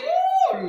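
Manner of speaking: long, very high-pitched "ooh"; sudden high exclaiming "ooh"; high drawn-out "ooh". Real, not acted. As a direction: let out a high, sustained ooh. Exclaims while reading.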